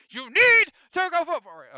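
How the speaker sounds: suddenly very loud, high-pitched mock outburst; mock scolding shout. Host hounding listeners to go vote, mock scolding shout. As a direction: yell it in over-the-top exasperation.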